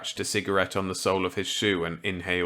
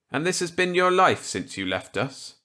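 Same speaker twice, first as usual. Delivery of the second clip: higher, louder character voice; eager reply to an old colleague; 10 semitones higher, 3 dB louder than usual. Acted. Walker accepting Millet's gambit eagerly.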